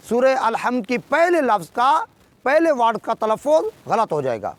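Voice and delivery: ordinary talk, nothing marked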